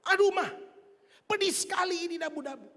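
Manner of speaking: acted husband's exasperated cry, pitched high; mock angry husband. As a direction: yelp at the wife over food too spicy. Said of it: "Jadi judul kemarahan suaminya apa?".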